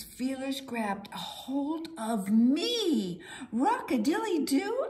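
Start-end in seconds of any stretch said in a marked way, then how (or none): 3.5-4.9 excited exclaim. A narrator's reading. Rock narrator exclaims.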